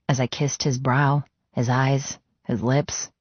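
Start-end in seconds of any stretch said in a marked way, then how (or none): none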